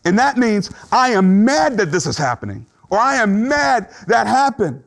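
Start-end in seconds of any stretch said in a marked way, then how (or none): 0.9-2.7 acted-out anger, raised pitch, hard stress on MAD. Preacher acting out the second stage of grief, angrily. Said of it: "the second stage is anger". 3.0-4.8 high, slowed protest with MAD stretched; anger. Acted. Preacher voicing a grieving person's anger, indignantly. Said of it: "the second stage is anger".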